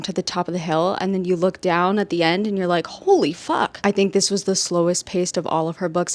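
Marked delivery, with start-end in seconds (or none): 2.9-3.8 high, swooping amazed exclamation; stunned reaction. Retold or imitated. Reader looking down at the end, stunned. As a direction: act out being floored, pitch jumping up. Acts out reaction to book's ending.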